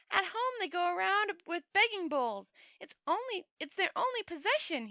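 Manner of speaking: high, eager, wondering tourist voice; a naive visitor gushing over a solemn ritual; Two Flower. Acted. The tourist telling Rincewind about the ceremony, high and eager.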